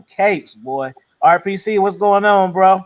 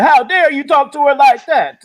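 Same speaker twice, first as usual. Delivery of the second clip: higher, louder mock outrage; mock indignant; 7 semitones higher, 5 dB louder than usual. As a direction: ham up the offended defender. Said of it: "the dude was like".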